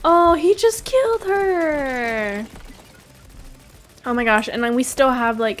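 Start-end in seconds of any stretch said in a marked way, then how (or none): 0.1-2.4 shocked cry. Real, not acted. Teammate killed by giant.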